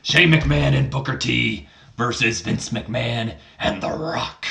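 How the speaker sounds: throat-straining announcer-like boss, big stretched names; Vince McMahon. Acted. Father laying out the stakes to his son, put-on boss voice. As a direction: put on a growly impression, dropping away at the ends. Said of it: "Vince McMahon, how do you speak?".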